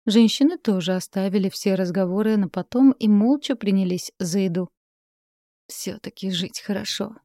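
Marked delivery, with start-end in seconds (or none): 5.7-7.1 contented sigh. A narrator's reading. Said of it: "подала голос Синицына".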